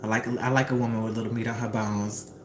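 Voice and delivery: low, lightly voiced suave man; a hint of a smooth neighbour; smooth man's line. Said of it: "he says back to her".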